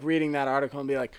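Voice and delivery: usual speaking voice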